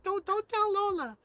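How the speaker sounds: put-on high-pitched little-kid plea; pleading child. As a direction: imitate a child begging not to be told on. Retold child's panicked plea repeated.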